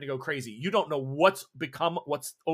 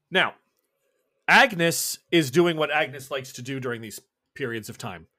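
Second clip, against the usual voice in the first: ordinary talk, nothing marked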